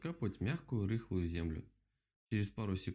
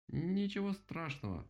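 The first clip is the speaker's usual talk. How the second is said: slow, higher-pitched stuttering woodpecker; drawn-out stammer; 8 semitones higher than usual. A narrator's reading. Woodpecker forgiving the rabbit, slow halting stammer. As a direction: stretch the first sound haltingly, pitched up.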